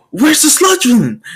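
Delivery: high, startled question; alarmed surprise. Acted. They discover the villain is gone, alarmed. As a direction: voice jumps up in mock panic.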